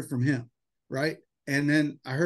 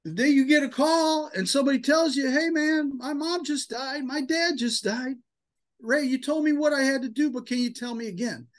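high, strained, tearful voice; choked up; 9 semitones higher than usual. Real, not acted. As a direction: fight back tears while speaking. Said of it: "I'm gonna get choked up again".

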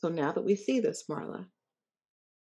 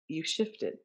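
ordinary delivery

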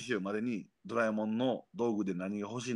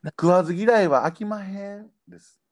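louder, higher Kyoto dialect; Kyoto accent; 7 semitones higher, 9 dB louder than usual. Shown as a demonstration. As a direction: say it like a Kyoto merchant. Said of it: "結構京都弁ですよ".

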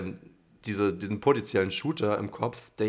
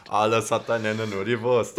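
laughing; 5 dB louder, slower than usual. Pretend. Joking proverb, laughing.

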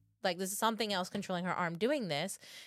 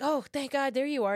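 higher, louder burst of relief; retold relief; 6 semitones higher, 5 dB louder than usual. Scared group finding the funeral director, relieved exclaiming.

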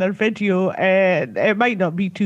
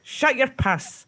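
slow, higher-pitched Scottish Borders put-down; mock scolding of a sister, slow and higher; telling off a sister; 4 semitones higher, slower than usual. Shown as a demonstration. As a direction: demonstrate a childhood sibling put-down, drawn out. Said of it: "in the way that you used to say it to her".

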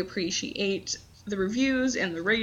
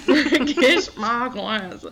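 very slow, drawn-out mock accent, a bit louder; imitating Gacy; 2 semitones higher, 4 dB louder, much slower than usual. Cohost repeating the Gacy line in a slow mock accent. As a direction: stretch each word in a bad impression. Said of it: "I can't even do his accent".

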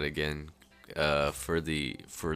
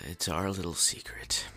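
conspiratorial whisper; 5 dB quieter than usual. Acted. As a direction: whisper it like a secret. Whispered secret banter.

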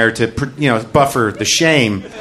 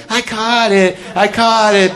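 put-on imagined kid's triumph; 10 semitones higher, slower than usual. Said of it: "a better moment to be like".

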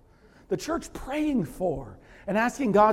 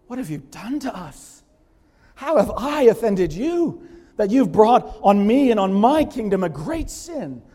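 indignant king; 6 semitones higher, 9 dB louder than usual. Acted. Abimelech confronts Abraham.

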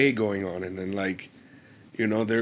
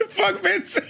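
laughing curse. Real, not acted.

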